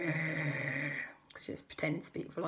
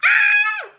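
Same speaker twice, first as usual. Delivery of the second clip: very high, very loud shriek; hammed-up terrified squeal; raptor scream; 16 dB louder than usual. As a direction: shriek in fright and flee. Raptors scared by roar.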